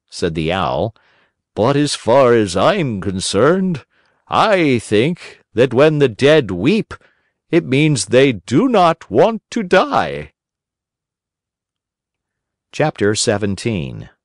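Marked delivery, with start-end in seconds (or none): 1.5-10.3 high, slow and prim; fussy owl doctor. A narrator's reading. Owl doctor politely contradicts the crow, high and prim. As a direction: apologise with prim owl dignity.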